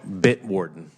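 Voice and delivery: Bitwarden said slowly and clearly; slowed-down repeat. Real, not acted. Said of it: "you say it really fast".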